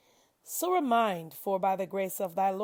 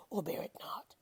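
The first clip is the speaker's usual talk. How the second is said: dropped to a bare whisper; 9 dB quieter than usual. Acted. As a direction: let the words fall away in a whisper. Quiet dismissive close of the verse.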